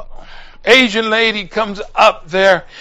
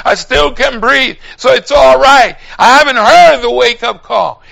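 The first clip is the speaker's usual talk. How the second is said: louder and higher, building the sermon; fiery preacher calling out to young people; preaching at full force; 6 semitones higher, 6 dB louder than usual. Acted. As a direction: drive the message home to the pews. Preaching, voicing excuses.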